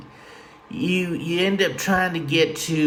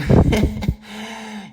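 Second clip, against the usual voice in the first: a higher, louder laugh; burst of laughter; 7 semitones higher, 3 dB louder than usual. Real, not acted. Host laughing at his own joke, loud laugh. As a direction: crack up.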